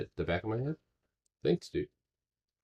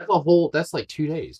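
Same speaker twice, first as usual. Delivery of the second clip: surprised; 10 semitones higher, 7 dB louder than usual. Real, not acted. Surprise at a big market drop.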